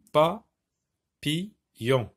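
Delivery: Papillon with long pauses, PA, PI, LLON; very slow syllables. Shown as a demonstration. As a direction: stretch the gaps widely in Papillon. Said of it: "la syllabe pi".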